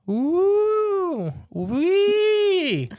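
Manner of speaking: very high, loud sliding whoop; eerie noise imitation. Recreating the noise that woke her, high whooping call. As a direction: imitate a strange night call, swooping up into falsetto. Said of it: "but long and low".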